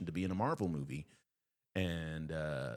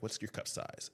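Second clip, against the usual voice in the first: soft, matter-of-fact question; offhand question; 3 semitones higher, 6 dB quieter than usual. Retold or imitated. Casting man to woman in line, offhand. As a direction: ask it quietly, as routine.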